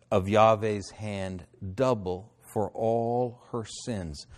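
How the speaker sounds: slow, measured scripture reading; deliberate reading with drawn-out words. Acted. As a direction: read it out solemnly, phrase by phrase. Reads scripture aloud.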